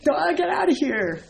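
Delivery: high, loud mock yell; hammed-up shove of a scolding; mock shout. Teacher playing Bai Zhang chasing students out with a mock yell. Acting out chased monks.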